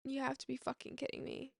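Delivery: soft, stunned disbelief; hushed incredulous reaction. Retold or imitated. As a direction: say it softly, as if in shock.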